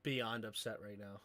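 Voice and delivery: low, hushed exasperation; mad, beyond upset. Real, not acted. Streamer upset at skipping internet, quietly mad. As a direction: keep the anger low and very quiet. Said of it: "I'm so mad".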